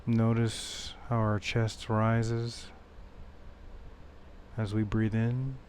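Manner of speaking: soft, unhurried meditation voice with long pauses; guided meditation. Acted. Meditation teacher leading listeners back to the breath, soft guided-meditation voice. Leads a meditation after intro.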